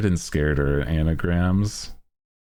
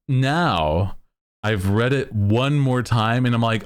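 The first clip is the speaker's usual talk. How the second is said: ordinary delivery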